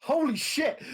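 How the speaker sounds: loud, high yell of disbelief; excited shout. Real, not acted. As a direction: react to a huge roll. Huge damage roll result.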